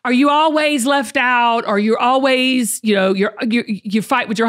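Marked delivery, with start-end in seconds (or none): none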